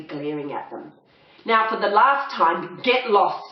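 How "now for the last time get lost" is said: louder snarling threat; a menacing final warning. Acted. Ivan warning the poodles, loud snarl. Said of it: "snarled Ivan".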